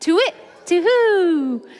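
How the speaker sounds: high, slow "To-wit, to-hoo"; owl hoot. Shown as a demonstration. Teacher leads the children in a slow high owl hoot. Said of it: "Can you say that?".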